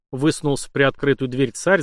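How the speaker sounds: ordinary delivery, nothing marked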